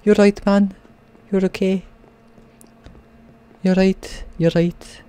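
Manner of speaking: high-pitched regional British voice; put-on British regional accent. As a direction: repeat it in a playful, maybe-Scouse accent. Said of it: "I'm not sure what accent that is".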